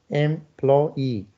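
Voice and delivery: "Employee" in three beats, em-ploy-ee; syllable by syllable. Shown as a demonstration. Teacher confirming three syllables, "Employee" syllable by syllable. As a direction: sound out each syllable of "Employee". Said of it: "Yes, three syllables".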